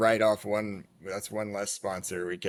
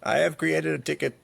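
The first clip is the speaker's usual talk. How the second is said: higher, louder attempt at an Indian help-desk accent; 6 semitones higher, 4 dB louder than usual. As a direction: try out a weak Indian call-center accent. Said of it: "I can't do the accent like Streaker".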